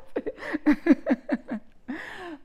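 loud, high burst of laughter; real laughter bursting out; big high laugh. Real, not acted. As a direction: crack up loudly. Laughs after mock panic.

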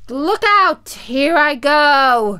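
high, loud, drawn-out shriek; loud wailing witch farewell; witch's last cry. A narrator's reading. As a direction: cry out as the witch melts away. Witch's final cry as she melts.

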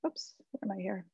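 usual speaking voice

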